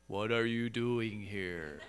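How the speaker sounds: slow, low, soft monotone; monotone, non-feeling God. Shown as a demonstration. Preacher mocks an emotionless version of God, slow monotone. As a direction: intone it slowly with no emotion at all. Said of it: "this monotone, non-feeling voice".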